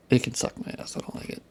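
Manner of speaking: breathy half-whispered repeat; whispered aside. Real, not acted.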